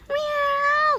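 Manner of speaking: very high imitated meow; long squealed cat meow. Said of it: "that cat noise".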